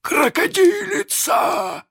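very high, drawn-out cry of dread; horrified exclamation. A narrator's reading. Hook hearing the crocodile approach, trembling with dread. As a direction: squeal the word slowly in terror. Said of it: "прошептал он".

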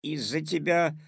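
put-on mildly offended boy; a touch of resentful blame; hurt, sulky. A narrator's reading. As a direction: sulk a little. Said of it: "обиделся Петя".

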